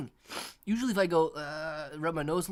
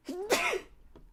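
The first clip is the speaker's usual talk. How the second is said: sharp real cough; a sudden hard cough. Real, not acted.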